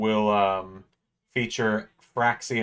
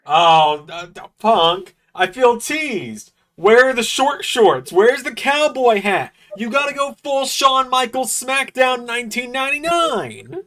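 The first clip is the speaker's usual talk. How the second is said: excited yelling; 11 semitones higher, 6 dB louder than usual. Real, not acted. Fan yelling at Punk on TV.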